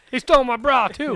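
put-on high-pitched, loud, offended girlfriend; high indignant woman voice. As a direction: squeal it like a wronged girlfriend. Mock complaint, girlfriend's voice.

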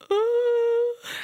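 put-on high wail like a small boy starting to cry; child bursting into tears. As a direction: let out a sudden high crying wail. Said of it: "burst into tears".

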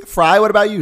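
ordinary delivery

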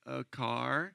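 put-on small, soft voice of a caught child; sheepish admission. Caught child admits to mother.

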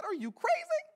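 very high, squeaky disbelief; incredulous refusal. Retold or imitated. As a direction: shoot way up in pitch, appalled. Acts refusing to go back.

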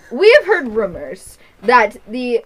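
slow and loud so the room hears; pretty loudly. Acted. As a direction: announce it to eavesdroppers, slowly. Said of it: "he says this pretty loudly".

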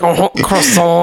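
slow, hammed-up French accent as a French orc; mock French accent. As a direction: play a silly French orc, drawn out. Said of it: "they'll be like".

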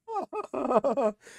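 real high-pitched cackle of amusement; high helpless laugh. Real, not acted. Streamer still cracking up at the memory, high helpless laughter. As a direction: let the laugh burst out high.

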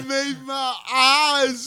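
slow, hammed-up sobbing voice; mock crying. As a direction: blubber it out like fake tears. Hammed-up weeping over Simple Jack.

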